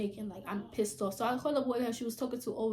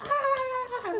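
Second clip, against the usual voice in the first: yell. Retold or imitated. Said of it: "I'm yelling at the bit".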